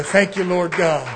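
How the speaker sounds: quiet, low altar-call voice; soft closing worship. Acted. Preacher closing the service with soft, hushed worship. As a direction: ease the room down into worship.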